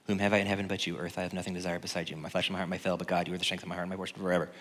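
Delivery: soft, quick recitation; scripture recitation. Acted. Preacher quoting a psalm to the congregation, soft quick recitation. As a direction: rattle the verse off softly from memory.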